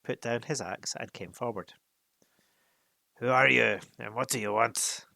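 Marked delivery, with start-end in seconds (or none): 3.2-5.0 loud gruff challenge. Acted. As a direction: bark it gruffly at strangers. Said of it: "he asked gruffly".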